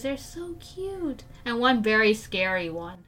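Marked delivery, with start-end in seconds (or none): 0.0-1.2 soft, high, drawn-out coo; real high, hushed cooing; cooing adoration. Real, not acted. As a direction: gush slowly and softly over something adorable. Gushing over baby kangaroos.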